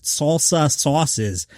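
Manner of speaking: slow, plummy British accent on "salsa sauces"; posh English accent. Shown as a demonstration. Podcast host mocks the press-release phrase, slow British accent. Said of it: "go into the Queen's accent".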